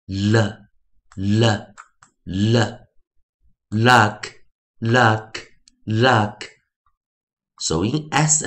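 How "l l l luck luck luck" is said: l as "luh", then luck whole; slow, spaced drill from l "luh" to luck; three separate l sounds, then three of luck; phonics blending. Shown as a demonstration. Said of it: "我们就发音为".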